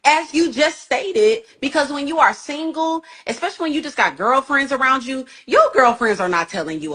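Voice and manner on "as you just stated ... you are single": ordinary delivery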